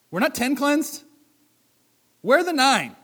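Jesus asks where the others went; Jesus questioning. Acted. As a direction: demand an answer, searching for the missing. Jesus voiced asking for the nine.

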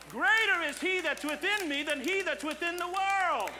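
loud pulpit preaching pushing hard on each phrase; full-force preaching. Acted. Pastor calls the church to pray together, preaching hard. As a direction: drive it home like a revival preacher.

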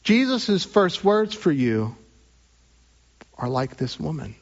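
ordinary delivery

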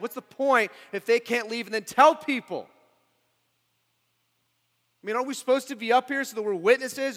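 loud, high, incredulous preaching; impassioned protest. Acted. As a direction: fire off baffled questions at full force. Voices the disciples' baffled protest.

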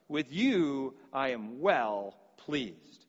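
slow, low, solemn divine voice; voice of God. Acted. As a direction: let God speak slowly from heaven. Voice of God from heaven.

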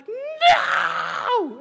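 very high, loud comic wail; anguished shout. Acted. Boy with pillow over his head at six, anguished shout. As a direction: cry it out in hammed-up agony. Acted cry at waking early.

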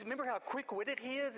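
ordinary talk, nothing marked

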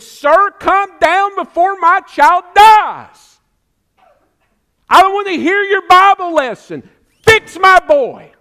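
loud, high, pleading cry; desperate plea. Acted. Nobleman begging Jesus for his dying son, loud desperate plea. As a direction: beg Jesus to come before the child dies.

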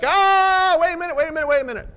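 high, panicky pleading crab; panicked crab. Acted. Crab dragged away in the net.

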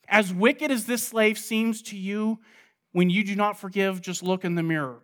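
ordinary talk, nothing marked

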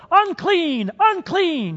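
high, loud shout of "Unclean!"; demo yell. Shown as a demonstration. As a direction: yell it out so the whole room hears. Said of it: "I would like you all to yell".

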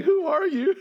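high laughing who-are-you; laughing disbelief. Retold or imitated. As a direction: ask it through a laugh, bewildered. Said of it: "I'm like".

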